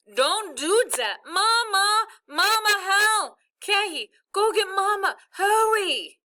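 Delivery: loud, very high wailing screams; frantic. A narrator's reading. Stuck boy screaming for mother's help, frantic. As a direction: scream for mother in total panic. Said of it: "Bai went nuts again".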